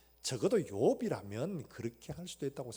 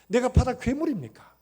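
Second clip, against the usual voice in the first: much louder, higher anguished question; anguished outcry; 8 semitones higher, 13 dB louder than usual. Retold or imitated. Job wailing at God in his suffering, full anguished outcry. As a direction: wail it up to God. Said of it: "그렇게 탄식하면서".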